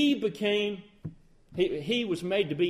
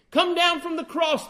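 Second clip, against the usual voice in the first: higher, taunting call up to the cross; mocking jeer; 8 semitones higher, 4 dB louder than usual. Mockers jeering at Jesus on the cross, taunting. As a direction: throw the taunt up at him. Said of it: "they mocked".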